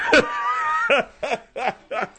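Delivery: squealing high laugh; real laugh at the joke; high burst of laughter. Real, not acted. Host laughs at own attempt.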